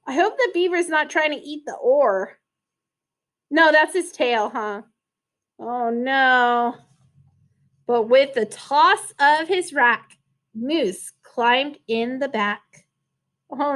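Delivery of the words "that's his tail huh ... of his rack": plain reading voice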